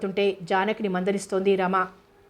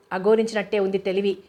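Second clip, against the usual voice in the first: ordinary delivery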